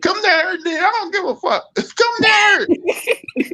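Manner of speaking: very high, squealing defiance; imitating an irate man in a screechy high voice; high-pitched mock yell. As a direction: yell it back way up high, half laughing. Retold victim yells back at caller.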